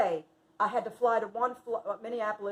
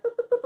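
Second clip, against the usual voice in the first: high, hopping little boops; imitated laser dot; 13 semitones higher, 4 dB louder, much faster than usual. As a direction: bounce playful boops like a darting dot.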